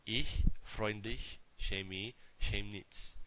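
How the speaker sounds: slow careful German ch; slow demo. Shown as a demonstration. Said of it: "listen to the first group".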